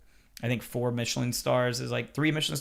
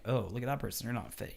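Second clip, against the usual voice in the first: judgy aside; 9 dB quieter than usual. Retold or imitated. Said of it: "I would look at them like".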